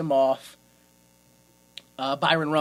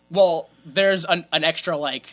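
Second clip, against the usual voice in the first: usual speaking voice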